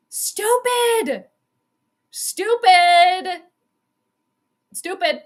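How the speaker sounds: high, disgusted stupid; exasperated. Real, not acted. Fan scolding the Bears' call, high exasperated. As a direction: spit it out in disbelief.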